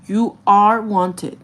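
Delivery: slow, weighty stress on "are"; emphatic insistence. Retold or imitated. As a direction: draw out "You are wanted", leaning hard on "are". Insists to grandma while holding hands.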